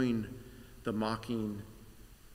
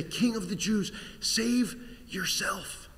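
urgent cry; 8 semitones higher than usual. Acted. Passengers calling to the crucified God, urgent high cry. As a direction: call up to him, desperate and high.

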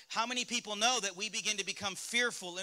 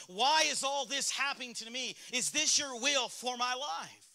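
raised, higher pitch, crying out at God; frustrated yelling; 5 semitones higher, 4 dB louder than usual. Acted. Frustrated believer in the car yelling at God, exasperated. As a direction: act out an exasperated yell, stretching the ending. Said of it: "you're yelling and you're screaming at God".

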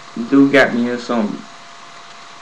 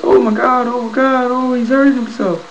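panicked officer; 13 semitones higher than usual. Retold or imitated. Female officer seeing him hurt himself, panicked shriek. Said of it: "And she starts".

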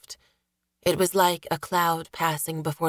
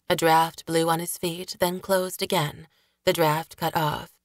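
plain reading voice

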